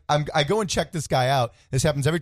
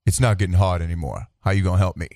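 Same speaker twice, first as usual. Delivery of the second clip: imitated big man; 7 semitones lower than usual. Big customer asking the clerk for help, imitated low.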